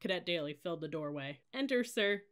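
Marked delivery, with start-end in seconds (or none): 1.5-2.2 high, squeaky voice; timid high-pitched invitation; nervous squeak. A narrator's reading. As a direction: squeak it out in fright. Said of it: "squeaked in a voice an octave higher".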